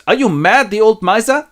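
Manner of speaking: high, loud indignant objection; indignant wife. Acted. The wife objects to toasting Scrooge, indignant.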